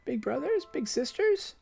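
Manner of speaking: put-on high, slow wondering child; a dying girl delighting in held hands; high happy child voice; joyful little girl. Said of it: "she asks joyfully".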